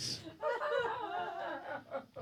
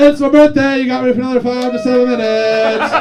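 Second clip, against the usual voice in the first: louder, higher through laughter; laughing; 9 semitones higher, 8 dB louder than usual. Real, not acted. Comic laughing to the crowd about his birthday, giggly and loud. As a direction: giggle out the excuse.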